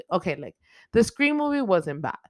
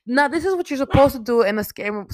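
put-on fierce final girl; 7 semitones higher, 7 dB louder, faster than usual. She's just like.

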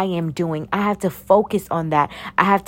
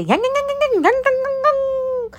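mock foreign chatter. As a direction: answer back in hammed-up fake language. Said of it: "open your mouth and say".

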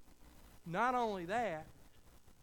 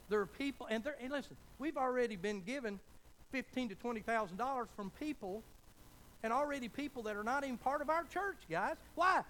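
louder, higher, swelling preacher's call; full-force preaching; 6 semitones higher, 4 dB louder than usual. Acted. Pastor preaching to the congregation about gifts pouring in, higher and louder. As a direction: build it up to a shouted why.

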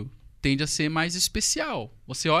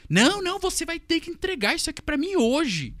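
much higher, louder furious client; mock shrill yelling; 11 semitones higher, 5 dB louder than usual. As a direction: shriek the refusal down at him. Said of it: "ela tava querendo humilhar mesmo". (gritando bem agudo, imitando a cliente furiosa)

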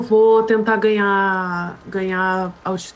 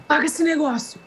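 raised-pitch urgent command; urgent order; 8 semitones higher than usual. Acted. Pérsia tells Miriam to put the fire out, urgently.

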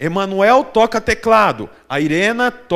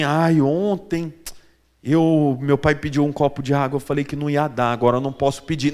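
put-on guilty child; 6 semitones lower, 4 dB quieter than usual. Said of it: "Ela não tá preocupada assim".

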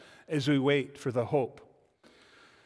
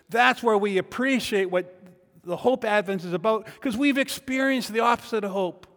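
full-force preaching; 6 semitones higher, 8 dB louder than usual. Acted. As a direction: press the questions home to the congregation. Pastor preaching call and response.

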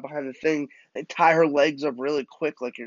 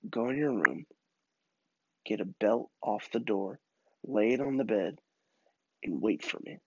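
stern dad; 2 semitones lower, 5 dB quieter than usual. Retold or imitated. Dad sending son to await punishment, quiet stern command. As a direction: hand down the sentence piece by piece.

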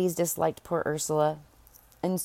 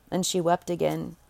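ordinary delivery

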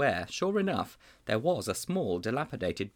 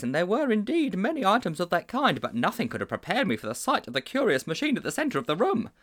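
a touch higher, earnest schoolmaster; lightly raised voice of a worried schoolmaster; lightly voiced teacher; 7 semitones higher than usual. A narrator's reading. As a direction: confide like a troubled old teacher. Teacher tells long story.

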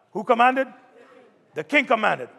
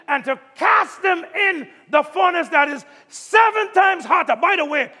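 raised, loud preacher's cadence building the story; preaching at full force; 6 semitones higher, 3 dB louder than usual. Acted. Pastor retelling the fiery furnace to his church, preaching at full force. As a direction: drive the furnace story home to the congregation.

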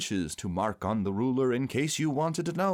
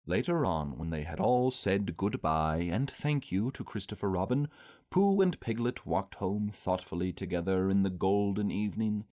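ordinary delivery

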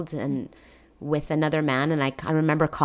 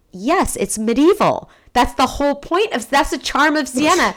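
higher, louder exasperated insistence; emphatic retort; 8 semitones higher, 6 dB louder than usual. Retold or imitated. Mother defending Siena to her daughter, exasperated and emphatic. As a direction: insist on the obvious, pitched up.

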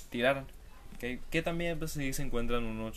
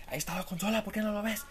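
exasperated viewer; 10 semitones higher, faster than usual. Retold or imitated. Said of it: "y tú decías".

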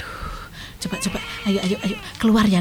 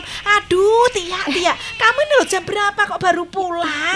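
relieved scolding; 8 semitones higher than usual. Acted. Grandma scolds daughter arriving late.

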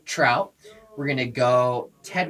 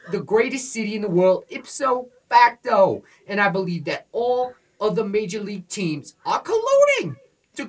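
put-on loud, higher New York pizza-shop guy accent, Yankees fan bravado; brash Northeast accent, high and punchy; Northeastern accent; 5 semitones higher, 3 dB louder than usual. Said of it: "specially requested that I do this in a Northeastern accent".

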